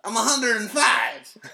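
high, loud, drawn-out comic boast; hammed-up broadcaster shouting out the age; Buck Martinez. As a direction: announce it proudly at full voice. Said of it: "I'll interview you as Buck Martinez".

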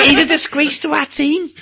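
high, loud mock Scouse caller; Scouse accent. Angry Scouser phoning in about the team, Scouse accent. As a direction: ring in like an outraged Liverpool fan. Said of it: "when Scousers ring up and say".

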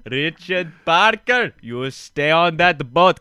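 drawn-out mock Indian man voice; Indian accent. Podcaster showing co-host the Indian man, exaggerated Indian accent. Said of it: "the Indian man sounds like this".

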